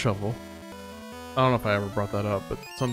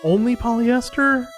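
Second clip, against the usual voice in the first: incredulous; 10 semitones higher, 5 dB louder than usual. Real, not acted. Reacting to donation message.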